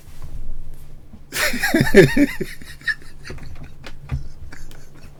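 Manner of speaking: breathless voiceless giggle; airy high wheeze of laughter; silent wheezing laugh. Real, not acted. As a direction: laugh with no voice left. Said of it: "I'm laughing".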